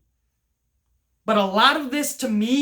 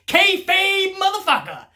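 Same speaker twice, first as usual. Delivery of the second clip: excited shout; 11 semitones higher, 4 dB louder than usual. Real, not acted. Excited exclamation about kayfabe.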